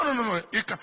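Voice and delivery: usual speaking voice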